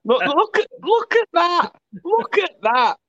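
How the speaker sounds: high, excited look at that. Real, not acted. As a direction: point and squeal at it. Excited pointing at tan.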